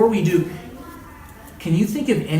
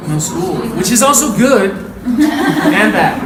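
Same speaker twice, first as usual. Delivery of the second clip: laughing out loud, slower and louder; 5 semitones higher, 8 dB louder, slower than usual. Real, not acted. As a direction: laugh through the words at the joke. Laughs at own joke.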